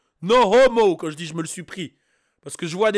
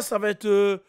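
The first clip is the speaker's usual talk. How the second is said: ordinary delivery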